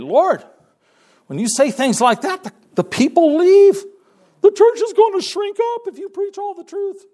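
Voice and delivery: high, loud pleading complaint; frustrated disciples. Acted. Disciples pleading with Jesus to soften preaching, high and loud. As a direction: voice the anxious disciples begging him to soften. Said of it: "the disciples got frustrated".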